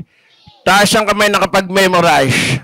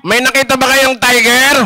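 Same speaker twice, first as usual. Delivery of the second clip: ordinary delivery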